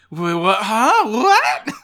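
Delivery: rising, increasingly high-pitched disbelief; shocked reaction. Retold or imitated. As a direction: build from a stammer to a loud squeaked "what". Acting out his shocked reaction.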